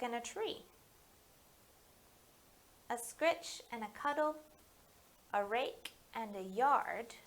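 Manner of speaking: gentle picture-book read-aloud; story reading to children. Acted. Librarian reading Finding Kindness aloud at story time.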